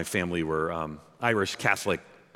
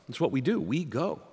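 normal talking voice

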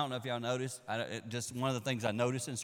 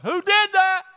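high, loud astonished call; mock shout across water; 16 dB louder, slower than usual. Sailor on another boat shouting, high and loud. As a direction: yell it over to the next boat. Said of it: "somebody on another boat probably went".

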